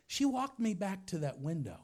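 normal talking voice

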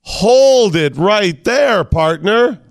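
drawn-out high cowboy call; slow, high "hold it right there". Pretend. Mock-stopping the host, "partner.

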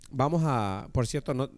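ordinary talk

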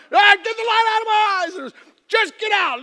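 a hammed-up dad blinded and fed up; mock furious dad.

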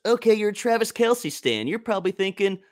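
high, patronizing, laughing at the end; mocking condescension. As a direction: talk down like to a clueless fan. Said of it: "someone did the reverse and goes".

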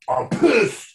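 high, loud, angry quote; hammed-up pitcher fuming; mock angry outburst. Pitcher quoted to reporters, high and loud. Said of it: "he certainly said".

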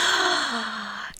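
sharp surprised gasp; excited gasp. Real, not acted. Friend hears she restarted The Good Place, excited gasp. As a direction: gasp with delight.

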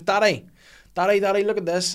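put-on high, loud child calling out for dad; imitating excited daughter. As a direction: mimic a kid demanding dad's attention. Young daughter calling for dad's attention.

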